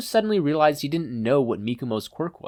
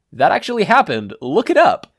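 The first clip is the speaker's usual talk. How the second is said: loud aside; 8 semitones higher, 9 dB louder than usual. Acted.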